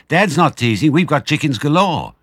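high, loud delighted insist; eager children. Acted. Small foxes telling Badger about the chickens, high delighted. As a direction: convince him, thrilled.

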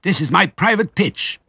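high, indignant shriek; high-pitched, furious little rat. A narrator's reading. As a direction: screech it shrilly to defend your patch. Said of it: "shrieked Rat".

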